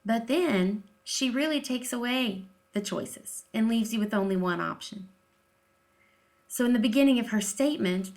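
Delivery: normal talking voice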